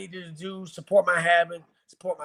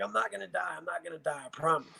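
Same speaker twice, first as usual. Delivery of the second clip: soft reassurance; 7 dB quieter, faster than usual. Retold or imitated. Reassuring frightened son.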